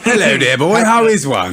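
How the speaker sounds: lightly hammed-up upper-class voice; mock posh toff. Friend mocks the officer as a posh toff.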